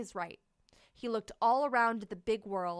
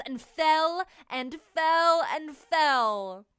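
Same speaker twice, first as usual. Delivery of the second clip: slow, rising, louder falling; drawn-out fall; 5 semitones higher, 7 dB louder, much slower than usual. A narrator's reading. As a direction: stretch out the long fall.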